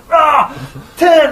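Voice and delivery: loud high strained gym grunt; straining grunt. Retold or imitated. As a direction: push out the last rep. Imitating gym guys straining.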